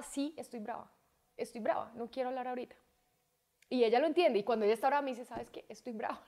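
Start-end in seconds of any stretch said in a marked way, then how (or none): 0.0-2.7 softly firm mother's tone; understated, controlled annoyance; composed retold anger. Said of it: "así, en este tono". 5.2-6.2 very soft mimicked child announcement; hushed, controlled mock annoyance; imitating daughter's composure. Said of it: "ella habla como hablo yo".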